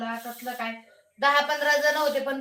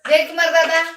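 calling his name out louder; 4 semitones higher, 9 dB louder than usual. Real, not acted. Streamer calls out loudly to Jaykumar on her live. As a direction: shout his name to get his attention.

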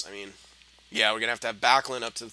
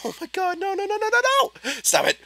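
real dismay, very high pitched no's; panicked squeal; 7 dB louder than usual. Real, not acted. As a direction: shriek in horror as the puck goes in. Opponent about to score.

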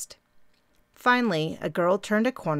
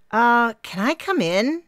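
slow, raised, hesitant question; 6 semitones higher, 5 dB louder than usual. A narrator's reading. Di interrupts Mary's chatter, slow and hesitant. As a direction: ask to come in out of the cold. Di cuts in, cold.

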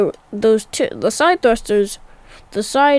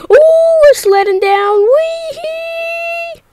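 high squealing wee-hee; sledding downhill; 5 dB louder, slower than usual. Real, not acted. Rover sliding down the hill.

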